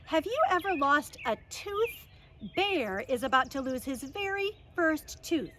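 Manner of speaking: usual speaking voice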